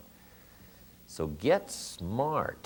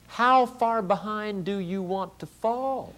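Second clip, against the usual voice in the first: high, slow challenge to the crowd; direct attack; 11 semitones higher, slower than usual. Real, not acted. Speaker confronts audience with a pointed high slow challenge. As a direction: jab at the listeners, drawn out. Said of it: "That was a direct attack".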